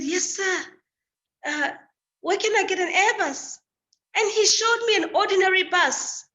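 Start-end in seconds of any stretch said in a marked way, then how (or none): 0.0-3.6 put-on a frightened girl asking the officer, pitch raised; nervous high asking. Arriving student asks the London policeman, high and nervous. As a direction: voice pitched higher, anxious and polite. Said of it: "I panicked".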